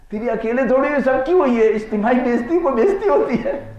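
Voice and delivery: very high, loud, squeaky with amusement; laughing punchline. Retold or imitated. Colleague telling the manager everyone was insulted, laughing high and loud. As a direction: repeat the punchline while cracking up, pitch way up.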